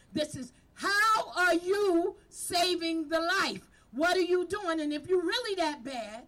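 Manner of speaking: rally them like a pulpit preacher; preaching at full force. Real, not acted. Community leader urging her outreach team, preaching at full force.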